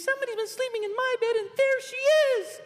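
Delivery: piercingly high, quick cartoon squeak; Baby Bear squeak. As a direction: squeal it like Mister Bill. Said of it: "Baby Bear squeaks".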